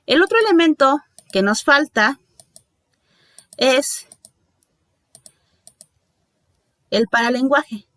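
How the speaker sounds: long dramatic pauses before "el paralenguaje"; suspense pause. Shown as a demonstration. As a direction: hold back the term, then reveal "el paralenguaje". Teacher builds suspense before term. (pausa dramática antes de "el paralenguaje")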